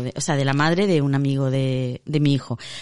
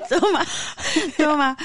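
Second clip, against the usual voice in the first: laughing offer; 9 semitones higher than usual. Retold or imitated.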